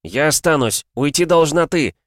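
high, defiant yell; insistent shouted retort; shouted back. A narrator's reading. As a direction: argue back at full voice. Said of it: "крикнул Джоран в ответ".

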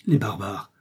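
ordinary delivery, nothing marked

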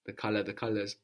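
ordinary talk

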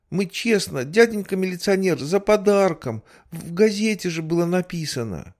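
put-on high, fast kid pleading; pleading child voice. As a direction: plead like a scared little boy. (детский умоляющий голос)

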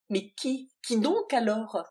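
loud frantic questions; shocked demand. Acted. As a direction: demand an answer in alarm. Adrienne confronts Valentine, shocked.

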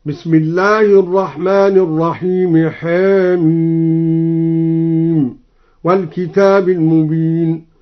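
tartil chant, حاميم with its first vowel slightly tilted toward e; taqlil recitation. Shown as a demonstration. Teacher showing al-Duri's reading, حاميم chanted with a half-tilted first vowel. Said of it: "قلل الدوري الحاء من حاميم هكذا".

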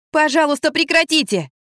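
high, urgent appeal; pleading. A narrator's reading. Narrator begging two arguers to stop, pleading.

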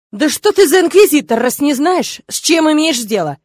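high, loud, exploding father; outraged dad barking at an incompetent; angry bark. A narrator's reading. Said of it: "рявкнул он на него".

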